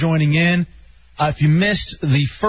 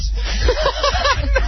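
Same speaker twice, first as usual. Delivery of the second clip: high burst of laughter. Real, not acted. Laughing at producer.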